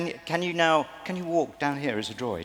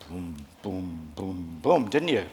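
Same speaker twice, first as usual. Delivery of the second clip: low, soft booms mimicking menacing steps; mock droid stomps in a low voice; imitated heavy footsteps; 8 semitones lower, 4 dB quieter, slower than usual. Coach mimics a contestant's frightening droid walk, low booms. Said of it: "quite a frightening walk".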